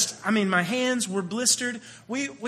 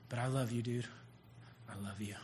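much softer, fading to a hush; tender confession; 9 semitones lower, 14 dB quieter than usual. Retold or imitated. Preacher retelling his words of love to the boy, hushed. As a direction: let it fall almost silent. Telling the kid he loves him.

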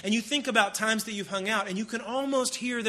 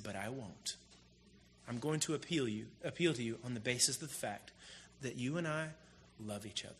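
softer, gentle plea from Paul; hushed, loving appeal; 7 semitones lower, 11 dB quieter than usual. Retold or imitated. Paul appealing to Philemon as a friend, softly. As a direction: ease down, plead in love.

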